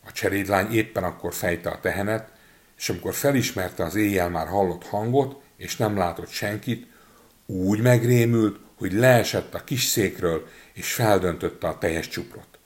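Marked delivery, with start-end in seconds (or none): none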